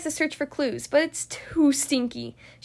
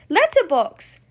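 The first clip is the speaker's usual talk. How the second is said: excited burst as it clicks; sudden realization; 3 semitones higher, 4 dB louder, slower than usual. Acted. Bluey solving the clue out loud, excited. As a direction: blurt the answer out, pitch jumping.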